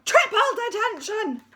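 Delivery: deputy head screaming the punishment; furious shout. Acted. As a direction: roar detention at the school.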